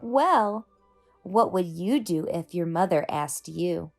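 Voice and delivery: plain reading voice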